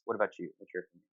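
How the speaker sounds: ordinary talk, nothing marked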